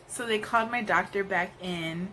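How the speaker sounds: ordinary talk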